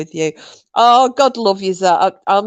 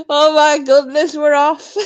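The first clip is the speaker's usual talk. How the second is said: higher, louder, cracking up; laughing; 5 semitones higher, 4 dB louder than usual. Real, not acted. Group sets off laughing again, laughing. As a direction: exclaim as the giggles take over. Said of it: "I'm here laughing".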